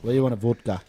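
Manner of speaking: lightly put-on Russian accent. Shown as a demonstration. Host offering a drink, Russian accent. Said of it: "I can kinda do a Russian accent".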